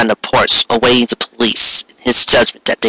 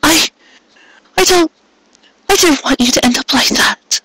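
softening. A narrator's reading. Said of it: "her voice softened".

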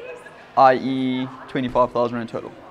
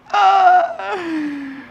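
sudden yelp; 4 dB louder than usual. Real, not acted. Sudden cry mid list.

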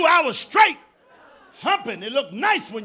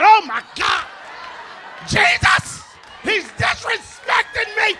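much higher and louder, slow wailing outrage; mock a woman acting like she got shot; hammed-up shriek of offense; outraged woman; 12 semitones higher, 7 dB louder, slower than usual. Woman overreacts to insult.

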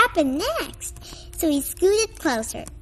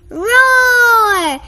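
loud, higher T-Rex roar; dinosaur roar; 8 semitones higher, 9 dB louder than usual. Acted. Froggy pretends to be a T-Rex in the library, loud roar. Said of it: "cried Froggy".

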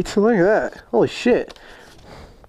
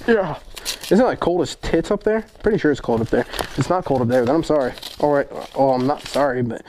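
ordinary delivery

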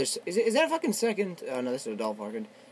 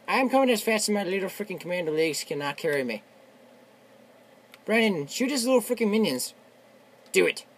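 ordinary delivery

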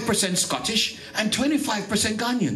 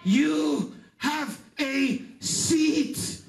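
higher, shouted gospel preaching with slow stretched calls; preaching at full force; 5 semitones higher than usual. Acted. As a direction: call out to the congregation like a revival preacher. Preaching climax, seats for every nation.

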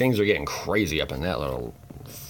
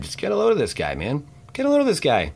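mock exclaim; 8 semitones higher, 4 dB louder than usual. Mock exclamation at card art.

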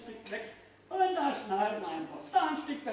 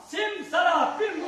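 slow, loud, drawn-out magic word; kids party magician; 6 semitones higher, 8 dB louder, slower than usual. Acted. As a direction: shout the spell grandly.

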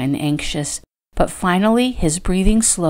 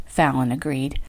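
plain reading voice

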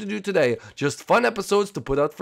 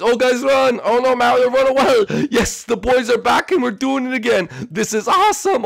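excited cheering; 7 semitones higher, 5 dB louder than usual. Acted. Running from Boom Boom, cheering.

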